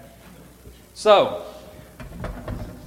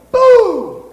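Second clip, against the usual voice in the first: high loud boo; yell boo; 11 dB louder than usual. Shown as a demonstration. Showing the angel how to scare, a loud boo. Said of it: "you're gonna yell".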